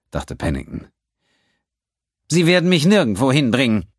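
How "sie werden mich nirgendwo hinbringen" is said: a much higher, louder, resolute refusal; determined. A narrator's reading. The accountant refusing to go with the pirates, determined. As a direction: plant your feet and refuse. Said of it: "Amnois Stimme klang entschlossen".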